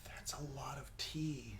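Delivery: hushed, voiceless aside; daunted whisper. Real, not acted. Co-host eyeing the poured drink, daunted whisper. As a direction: whisper it in dismay at the glass.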